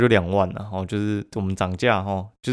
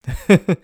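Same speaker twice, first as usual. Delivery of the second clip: sudden high, loud laugh; burst of laughter; 10 semitones higher, 8 dB louder than usual. Real, not acted. Vlogger laughing at his own ranting about clients, loud laugh. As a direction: laugh out at the absurdity.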